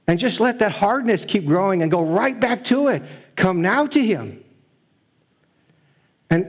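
plead, slowing on the key lines; preaching at full force. Acted. Preacher urging the congregation to come now, fervent.

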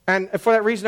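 usual speaking voice

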